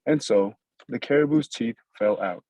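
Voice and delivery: usual speaking voice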